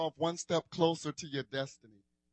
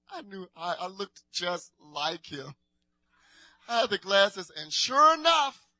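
ordinary talk, nothing marked

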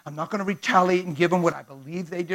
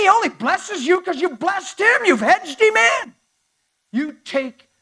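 loud, higher, sneering accuser; lightly voiced arrogant Satan taunting God; 11 semitones higher, 7 dB louder than usual. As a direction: challenge God to his face with contempt. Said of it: "He said".

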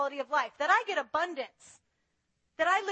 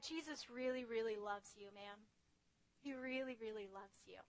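very soft, low and hushed; tender love; 5 semitones lower, 16 dB quieter than usual. Retold or imitated. Answering the cursing woman with soft, tender love. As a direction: speak love gently to someone cursing you. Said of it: "this incredible love ... said".

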